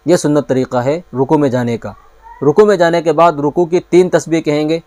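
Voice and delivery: ordinary talk, nothing marked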